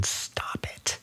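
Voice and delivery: silent whispered stop it; mouthed whisper. Retold or imitated. Mother mouthing at her needy son, voiceless whisper. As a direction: barely breathe the words out. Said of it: "while mouthing".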